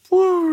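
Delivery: high "woo" sliding down; deflating woo. Acted. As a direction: let a high sound sink like escaping air. Sudden realization, balloon deflating.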